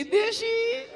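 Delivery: દેશી yelped very high with a rise; squeaky high desi. Retold or imitated. Widow stressing her husband drank only country liquor, squeaky high. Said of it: "કાકીએ પાંચ મુદ્દાઓ".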